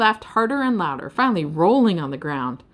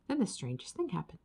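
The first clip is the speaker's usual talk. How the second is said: plain reading voice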